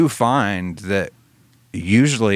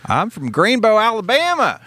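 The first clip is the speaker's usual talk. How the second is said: simple Southern man calling out his hometown, pitched way up; Forrest impression; 13 semitones higher, 3 dB louder than usual. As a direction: put on a high Alabama twang. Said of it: "Okay, Forrest".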